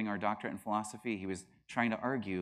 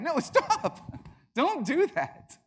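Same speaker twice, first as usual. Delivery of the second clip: high, loud, hammed-up pleading; mock alarmed protest; 6 dB louder, much slower than usual. Pantheist refusing a second jab, mock alarm. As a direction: fend them off in a squealing high voice. Pantheist begs not to be jabbed.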